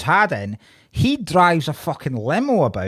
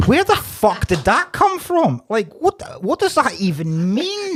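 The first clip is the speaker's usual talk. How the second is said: retold outrage, pitched up and incredulous; baffled reaction; 6 semitones higher than usual. Viewer reacting to a bizarre film line, baffled disbelief. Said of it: "And I'm like".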